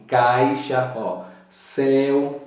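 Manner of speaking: caixa and céu said slowly with a wide open mouth; open diphthongs. Shown as a demonstration. Teacher showing open caixa, céu. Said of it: "Ele é mais aberto".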